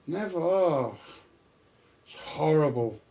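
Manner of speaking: ordinary talk, nothing marked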